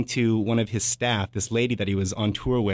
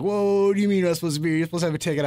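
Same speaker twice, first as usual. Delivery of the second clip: higher, quick, incredulous mock bouncer; surprised bouncer; 8 semitones higher, much faster than usual. Narrator imitating the bouncer catching a gatecrasher, mock surprised. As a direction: retell the bouncer's startled challenge. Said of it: "And this bouncer's like".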